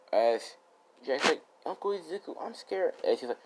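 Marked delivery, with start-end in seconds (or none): 1.6-2.9 put-on a child's voice, much higher; small and frightened, calling to his uncle; child clinging to his uncle in fear; scared little boy. Little Jack voiced in story.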